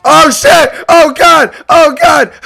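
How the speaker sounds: loud startled yelps; scared shouting. Real, not acted. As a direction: shout in sudden fright. Sudden scare in horror game.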